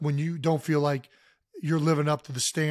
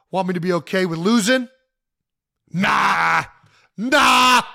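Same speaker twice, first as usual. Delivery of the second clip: building nah; 3 semitones higher, 6 dB louder, slower than usual. Retold or imitated. Acting out Diggs tweet, building.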